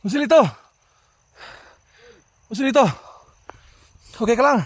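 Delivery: high urgent shout across the field; calling out. Real, not acted. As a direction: yell the name to find him. Calling out to a hit teammate.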